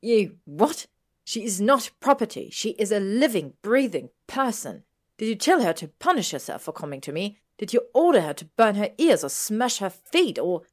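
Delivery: loud, high, furious tirade. A narrator's reading. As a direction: crackle with fury, building as you go. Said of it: "Hermione crackled with fury".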